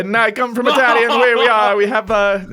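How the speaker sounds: put-on stupid Italian accent. Motivational speaker introducing himself at the interview, silly Italian accent. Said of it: "why do I get this stupid accent".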